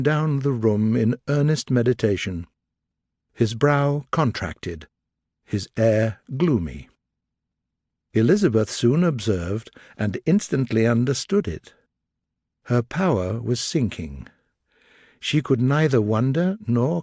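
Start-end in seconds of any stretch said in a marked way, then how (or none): none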